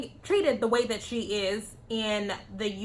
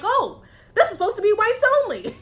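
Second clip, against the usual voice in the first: high, quick, louder mock astonishment; mock surprise; 10 semitones higher, 5 dB louder, faster than usual. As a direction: mimic feigned shock, breaking into laughter. Mocking quote of shocked people.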